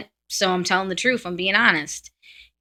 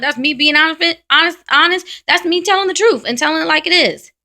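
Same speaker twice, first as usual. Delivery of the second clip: higher, louder, hammering honest honest honest; fired-up insistence; 8 semitones higher, 7 dB louder than usual. Real, not acted. To people calling Sagittarius fake, loud and fed up. Fed-up rant about being blunt.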